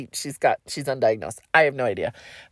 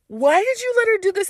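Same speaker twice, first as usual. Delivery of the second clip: high-pitched mock parental scolding; parent's outraged question, pitched way up; scolding parents; 14 semitones higher than usual. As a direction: imitate an exasperated parent demanding an answer. Parents scolding her in retold story.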